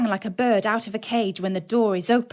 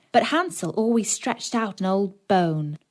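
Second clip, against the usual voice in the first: ordinary delivery, nothing marked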